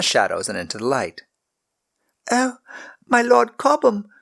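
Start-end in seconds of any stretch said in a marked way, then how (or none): 2.3-4.1 high, loud gasp of recognition; realising who he is, stunned; awed girl. A narrator's reading. Servant girl recognises the lord, high and awed. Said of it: "Megan whispered".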